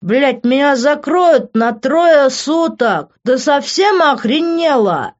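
loud, high, drawn-out bellow; furious slurred shouting; drunken yelling. A narrator's reading. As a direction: holler in slow drunken outrage. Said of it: "заорал он".

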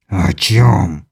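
low, slow inhuman voice; slow and threatening, from deep down; menacing alien. A narrator's reading. (низкий зловещий голос чужака)

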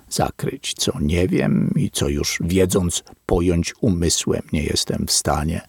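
usual speaking voice

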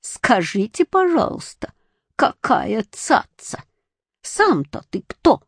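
slow teasing jab, voice jumping high; mock the boy's airs. A narrator's reading. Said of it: "ответил ему очень насмешливо". (очень насмешливо)

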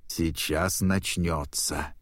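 ordinary delivery, nothing marked

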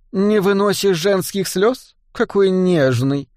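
nun teasing dwarf about tears; smirking mockery. A narrator's reading. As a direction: tease him with a smirk. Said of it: "усмехнулась монахиня".

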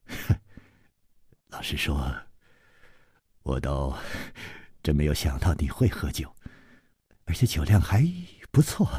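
low, soft, lightly amused admission; soft smiling voice; said with a smile. A narrator's reading. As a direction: admit it with quiet amusement. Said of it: "笑着说".

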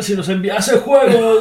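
loud, high shout through laughter; helpless laughing exclamation; laughing outburst. Real, not acted. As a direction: crack up while calling it out. Joking shout over both laughing.

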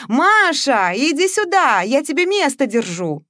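high, loud call; shouting across house. A narrator's reading. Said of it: "закричал на весь дом". (кричит на весь дом, высоко и громко)

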